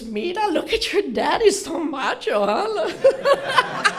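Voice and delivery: high, teasing, swooning mom; flirty mom.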